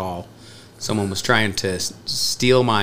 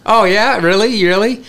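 mock disbelief; 9 semitones higher, 4 dB louder than usual. Mocking scammer on phone.

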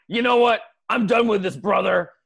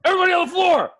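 commanding shout; 6 semitones higher, much faster than usual. Acted. SWAT team storming in.

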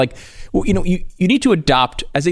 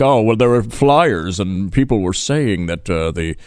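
slow, low droning politician impression; lower, ponderous excuse-making voice; John Kerry; 6 semitones lower, slower than usual. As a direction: drag it out low, like a stiff politician. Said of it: "John Kerry sounding like that".

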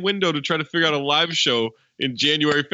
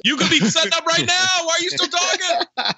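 impatient shout. Real, not acted. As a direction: yell at him to hurry up. Pressuring late friend, shouting.

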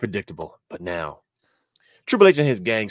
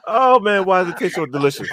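laughing; 9 semitones higher, 6 dB louder than usual. Real, not acted. Laughing at the joke.

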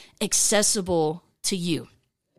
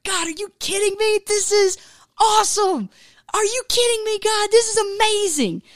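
amazed wonder; 11 semitones higher than usual. Pretend. A listener stunned and thrilled as God answers. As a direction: gush in disbelief at God's gift.

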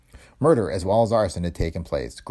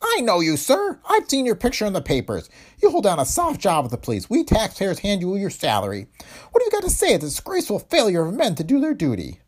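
high, loud scornful attack; high-pitched lashing out; 12 semitones higher, 3 dB louder than usual. A narrator's reading. Owner berating the police inspector, high scornful shout. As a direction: lash out at the inspector, voice high and sharp. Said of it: "His high-pitched voice lashed out".

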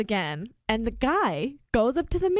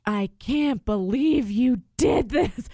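slow, drawn-out mock outrage; retold quarrel; 3 dB louder, slower than usual. Diner confronting partner after the manager leaves, imitated indignation. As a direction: imitate someone appalled, stretching each word. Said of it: "they got in a fight".

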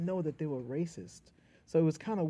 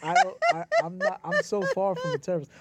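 retold protest through laughter, pitched up and louder; loud, high, laughing objection; 4 semitones higher, 9 dB louder than usual. Comedian retelling his audition answer, laughing protest.

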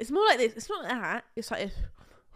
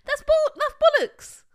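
loud, high-pitched objection; outraged and amused, voice jumping up; indignant protest; 13 semitones higher, 8 dB louder than usual. Real, not acted. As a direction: burst out in disbelief. Protesting his mock imitation of her.

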